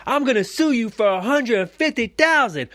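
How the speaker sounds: high, loud mock yell; retold yell. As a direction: yell the threat like a sore stranger. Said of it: "the first thing he yells out is".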